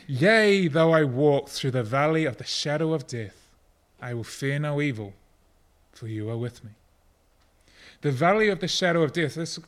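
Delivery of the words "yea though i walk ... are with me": solemn Psalm reading; scripture reading. Acted. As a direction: read the psalm aloud, strong then hushed. Reads Psalm twenty-three aloud.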